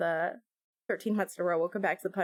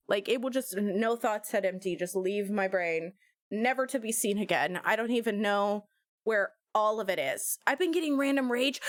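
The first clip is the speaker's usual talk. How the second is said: usual speaking voice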